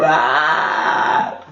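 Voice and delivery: long drawn-out mock cry; demonstrated yell. Shown as a demonstration. Host showing co-hosts how the chant ends, drawn-out yell. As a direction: let out a rehearsed shout. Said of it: "and then we go".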